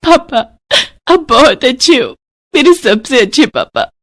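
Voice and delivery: put-on young daughter speaking to papa, childlike; little girl voice. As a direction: speak as a small girl hugging her father.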